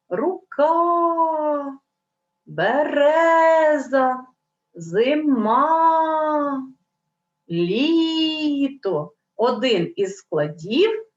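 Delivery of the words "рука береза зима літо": words called out higher, like hailing someone; calling out. Shown as a demonstration. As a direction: call each word out as if to a friend far away. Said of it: "ніби погукаємо". (вигукуючи, ніби гукаєш здалеку)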